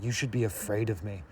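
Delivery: low, hushed mock menace; Edward. Vampire warning the girl, mock-ominous. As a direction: warn softly in a put-on brooding voice. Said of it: "He's like".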